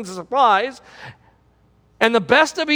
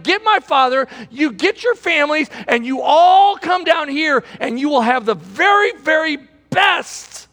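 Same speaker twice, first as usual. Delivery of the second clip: proclaiming Joseph; 8 semitones higher, 3 dB louder than usual. Retold or imitated. Joseph voiced in sermon, proclaiming.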